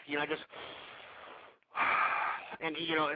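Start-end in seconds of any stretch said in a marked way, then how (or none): 1.8-2.4 long, airy exhale; deep breath sigh. Shown as a demonstration. Stressed radio host calming himself, deep breath let out. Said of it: "I take a deep breath".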